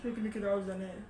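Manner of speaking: too exhausted to push the voice; real late-night fatigue, head aching; drained and tired. Real, not acted. Exhausted after five-hour blackout.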